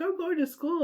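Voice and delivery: put-on high, chirpy child's voice; little kid voice. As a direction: sound like a proud little girl. Kid announces she's going to school.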